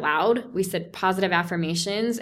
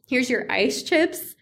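mocking nurse; 4 semitones higher, slower than usual. Women that are told.

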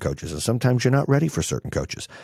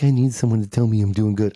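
mock needy voice. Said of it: "they're like".

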